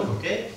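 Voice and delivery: "por qué" high and soft, jumping up on "qué"; pointed question intonation. Shown as a demonstration. Councillor demonstrating a question to the mayor, high rising "por qué". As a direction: lift "qué" right up as an obvious question. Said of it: "Es una pregunta".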